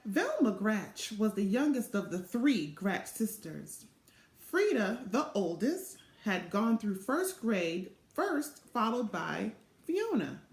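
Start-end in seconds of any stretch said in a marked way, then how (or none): none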